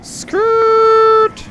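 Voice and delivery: loud, very high, long-held squeal; piercing drawn-out cry; high-pitched screech. Pretend. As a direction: screech the word out on one high note. High drawn-out exclamation in game.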